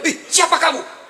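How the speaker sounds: slow, high startled cry with a laugh; startled husband. Acted. Husband startled by a giant creature from the well, mock fright. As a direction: play the husband's jolt of shock. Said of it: "Kaget suaminya".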